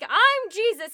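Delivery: high, loud self-important proclamation; mock grandiose. Teller mocks Richard's Jesus act, mock grandiose.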